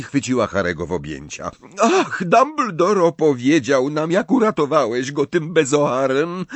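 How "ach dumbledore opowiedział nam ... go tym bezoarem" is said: through tears. A narrator's reading. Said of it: "powiedziała przez łzy".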